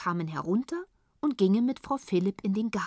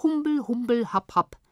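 higher-pitched silly babble in a put-on voice; silly gibberish; 4 semitones higher, 4 dB louder than usual. A narrator's reading. Tibetans answer Uncle Titus's question, silly gibberish. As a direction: babble nonsense syllables in a silly figure's voice. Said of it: "grinsten albern und sagten".

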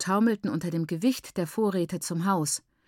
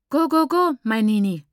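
higher and louder, calling through the house; 4 semitones higher, 6 dB louder than usual. A narrator's reading. Aunt calling to the sick mother from the living room, bright and loud. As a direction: sing out a cheerful knock from the next room. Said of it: "rief Maiguru".

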